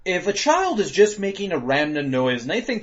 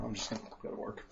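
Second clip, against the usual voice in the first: resigned mumble; 5 semitones lower, 22 dB quieter, faster than usual. Retold or imitated. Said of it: "I realized".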